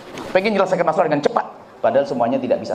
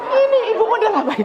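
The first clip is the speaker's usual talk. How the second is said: incredulous exclamation; faster than usual. Real, not acted. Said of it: "preacher mocks scolding mothers".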